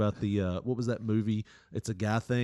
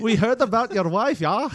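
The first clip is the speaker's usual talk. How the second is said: higher, louder fake Dutch twin voice with laughter; laughing mock Dutch accent, pitched up; 11 semitones higher, 8 dB louder than usual. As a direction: ham up a Dutch girl catfishing him. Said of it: "the Dutch twins sound so manly".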